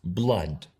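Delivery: Blood with a short open vowel, /blad/; English pronunciation. Shown as a demonstration. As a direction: say Blood the English way, not as written. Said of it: "¿La has pronunciado igual que yo?".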